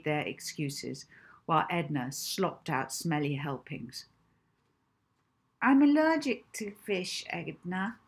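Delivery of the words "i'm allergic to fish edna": put-on pleading child. Child pleading excuse to cook.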